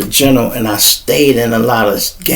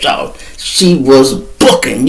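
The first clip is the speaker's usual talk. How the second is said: ordinary talk, nothing marked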